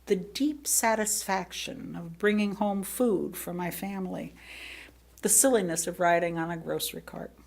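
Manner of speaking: ordinary delivery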